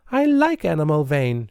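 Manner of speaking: understated sob in the voice; voice broken with sobs. A narrator's reading. Nancy defending Anna Mulvane, mild tearful cry. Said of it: "in a voice all broken with sobs".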